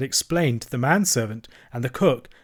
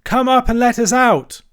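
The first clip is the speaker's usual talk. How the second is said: shouting; 11 semitones higher, 7 dB louder than usual. A narrator's reading. Cyril calling rescuers from the tower, shouting. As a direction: holler down for rescue. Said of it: "shouted Cyril from the church tower".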